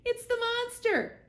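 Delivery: very high, loud alarmed screech. Acted. The owl screeching at the robot, alarmed and high. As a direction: screech it in fright.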